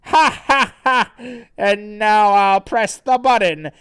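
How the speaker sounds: high, loud gloating villain; theatrical threat broken by evil laughter; Santa. Acted. Villain taunts trapped Sonichu, loud and gloating. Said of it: "And then Santa says".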